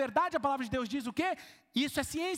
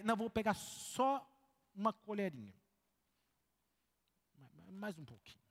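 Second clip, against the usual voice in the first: sheepish self-talk; 4 semitones lower, 7 dB quieter than usual. Retold or imitated. Acted self-talk at freezer.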